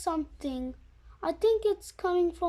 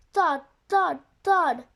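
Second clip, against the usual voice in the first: booming thuds; 7 dB louder than usual. A narrator's reading. Pirate mimics monster's footsteps.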